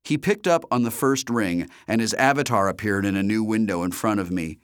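ordinary delivery, nothing marked

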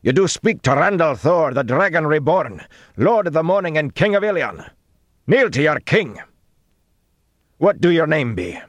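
high, loud, indignant proclamation; snapped. A narrator's reading. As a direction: shout it as an outraged herald. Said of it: "Gregorin snapped".

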